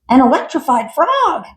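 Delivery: high, loud panicky quacking voice; frantic duck. Acted. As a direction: squawk it like a terrified duck. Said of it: "quacked a frantic duck".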